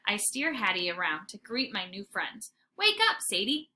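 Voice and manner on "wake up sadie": playful wake-up call. Acted. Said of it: "I say".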